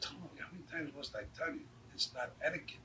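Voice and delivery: soft, discreet scolding at the table; hushed reproof. Retold or imitated. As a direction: chide him quietly so others won't hear. Shirley scolding him under his breath.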